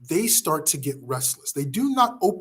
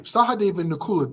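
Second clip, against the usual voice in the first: normal talking voice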